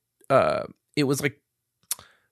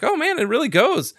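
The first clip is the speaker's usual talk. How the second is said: surprised; 12 semitones higher than usual. Retold or imitated. Surprised by the gas flow.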